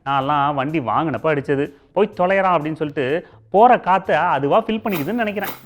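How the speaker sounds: ordinary talk, nothing marked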